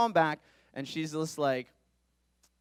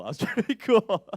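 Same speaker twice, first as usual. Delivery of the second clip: higher and louder, laughing through it; 7 semitones higher, 6 dB louder than usual. Real, not acted. To the audience, repeating the line while laughing. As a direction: break into real laughter on the line.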